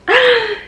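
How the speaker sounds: sharp, high gasp of joy; high thrilled gasp; delighted gasp. Real, not acted. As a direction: gasp like you just saw the surprise. Overjoyed at approved announcement card.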